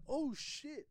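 high, loud "oh shit"; alarmed, pitched well up; retold alarm. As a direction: blurt it out as the car comes at you. Retold alarm as car comes.

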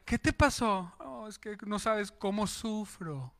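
soft, slow mock lament; self-pitying sufferer. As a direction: play the poor sufferer, quietly. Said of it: "para que digan". (lamento exagerado en voz baja)

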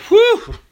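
high-pitched acted "whew"; relieved whew. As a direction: let out a high whoop of relief. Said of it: "Relieved, Bill said".

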